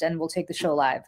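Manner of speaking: usual speaking voice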